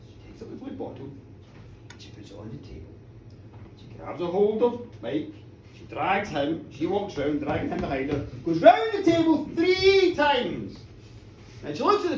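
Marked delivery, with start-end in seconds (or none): 0.1-6.3 very soft, quick, almost whispered; hushed suspense. A narrator's reading. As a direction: draw listeners into the secret. Hushed suspense before magic.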